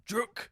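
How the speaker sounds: high sharp mock stab sound; stab noise. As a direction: spit out a stabbing noise. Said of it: "you just went".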